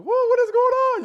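put-on nervous young man yelping as the plane takes off; panicked flyer. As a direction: squeal like a scared first-time flyer. Nervous first-time flyer at takeoff.

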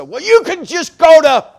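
loud, high angry shout; ticked-off yell. Retold or imitated. Ticked-off guy yelling across the shop. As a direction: yell it like a fed-up mechanic. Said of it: "he was ticked off".

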